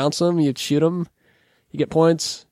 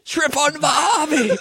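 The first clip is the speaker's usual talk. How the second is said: high, drawn-out cartoon Aussie; mock Australian accent; 6 semitones higher, slower than usual. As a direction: stretch it in silly fake Australian.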